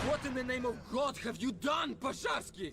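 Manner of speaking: shouting in horror and rage; outraged, disbelieving shout; furious shout. Acted. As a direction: yell it at the man who betrayed you. Horrified at a massacre.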